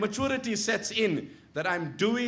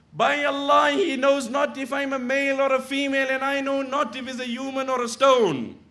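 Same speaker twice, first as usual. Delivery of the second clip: loud, high, pleading declaration; pleading proclamation, full voice; impassioned cry; 6 semitones higher, 5 dB louder than usual. Retold or imitated. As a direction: cry it out with raw conviction. Woman's words repeated passionately.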